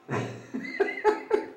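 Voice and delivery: breathy airy laugh without voice; a soft unvoiced burst of laughter; real laugh. Real, not acted. Veteran laughing at his own point, airy and unvoiced.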